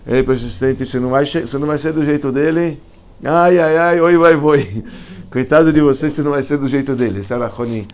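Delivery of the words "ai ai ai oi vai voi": high, loud exaggerated moaning; mocking a person who complains instead of persisting; mock whining. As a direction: whine like someone who gives up.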